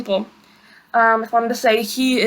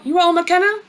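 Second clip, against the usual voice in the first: "You are all McKenna?" rising high at the end; question rise; 6 semitones higher, faster than usual. Shown as a demonstration. Teacher to learners, English question with a rising tune. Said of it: "as a question like".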